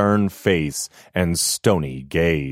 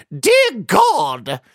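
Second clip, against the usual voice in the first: high, loud shocked cry; cried out; 13 semitones higher, 5 dB louder than usual. A narrator's reading. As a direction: gasp out in horror. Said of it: "she cried".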